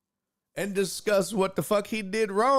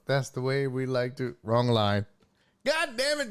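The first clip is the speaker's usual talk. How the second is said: ordinary delivery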